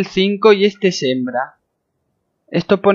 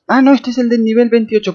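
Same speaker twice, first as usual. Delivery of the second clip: high, louder sudden realization; surprised realization; 7 semitones higher, 4 dB louder, faster than usual. Real, not acted. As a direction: catch yourself in surprise. Realizes which one it is.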